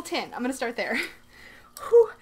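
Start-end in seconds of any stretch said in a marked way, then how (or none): 1.9-2.1 excited breath out. Real, not acted. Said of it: "Breathe, [name]".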